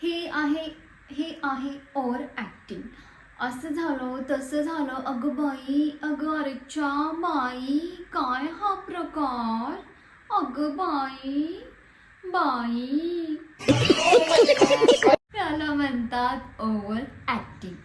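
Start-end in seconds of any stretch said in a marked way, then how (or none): 0.0-13.4 hammed-up, slow melodramatic exclamations; over acting. Shown as a demonstration. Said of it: "आता मी करून दाखवते तुम्हाला". 13.4-15.1 loud high laugh. Real, not acted. Laughs after her overacting demo.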